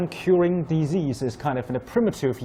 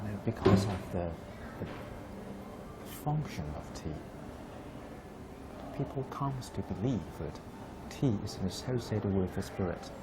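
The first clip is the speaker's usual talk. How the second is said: hushed, much softer voice; very low voice; 3 semitones lower, 9 dB quieter than usual. Real, not acted. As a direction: keep it low so you don't disturb the ceremony. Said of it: "we need to kind of speak in a very low voice".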